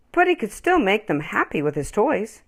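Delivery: ordinary delivery, nothing marked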